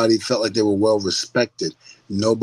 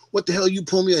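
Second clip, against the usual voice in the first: higher-pitched mock outrage; indignant mimicry; 7 semitones higher, much faster than usual. Mimicking drivers pulled over by police, acting big and bad.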